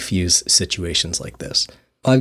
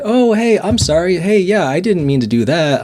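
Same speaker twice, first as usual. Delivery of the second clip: higher, bright and eager "hey, I'm sorry"; imitated friendly apology; 7 semitones higher than usual. To an angry stranger, a higher, eager apologetic voice. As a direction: play the disarming peacemaker, pitched up.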